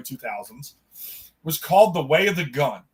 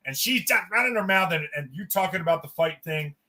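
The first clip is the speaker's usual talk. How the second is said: normal talking voice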